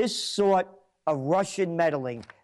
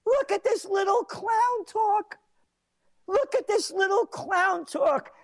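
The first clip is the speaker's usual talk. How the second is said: mocking shout; 12 semitones higher than usual. Real, not acted. Host mocking the governor's statement.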